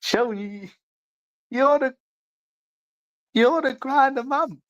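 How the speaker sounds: high, slow sing-song mock voice; childish falsetto teasing; mocking taunt. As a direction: jeer at him in a silly high voice. Taunting a chatter.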